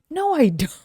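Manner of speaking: high loud flat denial; indignant denial. Retold or imitated. Denying jealousy to a coworker, high and indignant. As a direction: deny it with mock outrage. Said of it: "I was like".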